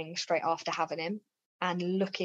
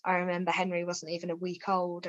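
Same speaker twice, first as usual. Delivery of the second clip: ordinary talk, nothing marked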